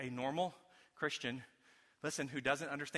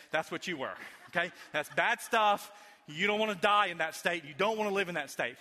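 usual speaking voice

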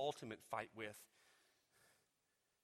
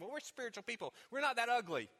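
self-righteous thought; 8 semitones higher, faster than usual. Retold or imitated. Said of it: "And you're thinking".

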